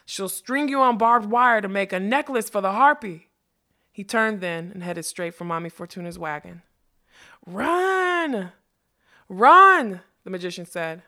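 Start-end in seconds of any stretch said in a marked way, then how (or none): 0.1-3.3 louder, higher, menacing taunt; gleeful threat. A narrator's reading. Ruck threatening the thieving magician, loud menace. 7.4-10.0 high, drawn-out frantic yell; urgent shout. A narrator's reading. Magician warning the unicorn to flee, frantic shout.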